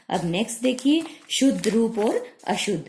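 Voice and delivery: ordinary delivery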